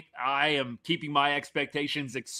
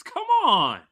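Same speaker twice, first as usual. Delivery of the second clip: much louder and higher, exasperated; frustrated yell; 11 semitones higher, 8 dB louder than usual. Real, not acted. Host yelling at his team's defense, frustrated shout.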